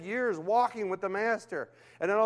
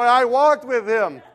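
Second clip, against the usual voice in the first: louder, slow, laughing through it; 6 semitones higher, 11 dB louder, slower than usual. Real, not acted. Pastor to the congregation about Roy, laughing loudly. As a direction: laugh as you drive it home.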